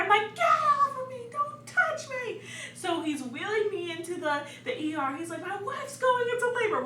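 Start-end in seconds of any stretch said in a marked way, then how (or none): 0.4-2.4 very high, strained mock screech; retold going off. Labouring wife rejecting husband's help, retold angry outburst. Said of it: "I was going off on anyone". 5.4-6.8 high, mock-panicked announcement; dramatic husband. Husband wheeling wife into the ER, imitated dramatic cry. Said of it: "We're so dramatic".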